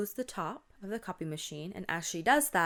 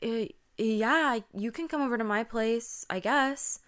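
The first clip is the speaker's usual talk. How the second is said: higher-pitched, hesitant yes; reluctant agreement; 4 semitones higher, 4 dB louder than usual. Acted. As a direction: agree as if unsure about it. Acted friend reluctantly agrees.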